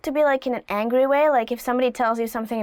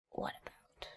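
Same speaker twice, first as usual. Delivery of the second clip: hushed aside; 22 dB quieter than usual. Shown as a demonstration. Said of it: "I'm angry".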